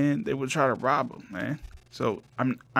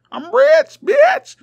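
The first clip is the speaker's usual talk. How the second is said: much higher, much louder triumphant shout; boastful yell; 12 dB louder, much slower than usual. Acted. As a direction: scream it out like a bragging dragon. Dragon boasting then shouting.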